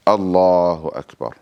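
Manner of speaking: slow, drawn-out takbir recitation; prayer said aloud. Shown as a demonstration. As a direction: intone it aloud, stretching the long vowel of Allahu. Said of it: "So I'm saying it aloud".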